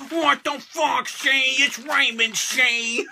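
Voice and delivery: wrestler impression. Correcting Shane on a fan's request, high wrestler impression. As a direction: complain in a mock wrestler's voice. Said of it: "say my name like Super Cena, Kane, and Roman Reigns".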